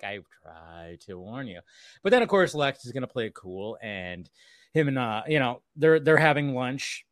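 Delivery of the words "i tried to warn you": much lower and softer, slow; hushed told-you-so. Retold or imitated. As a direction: murmur it slowly like a quiet warning. Voicing Mercy, much softer.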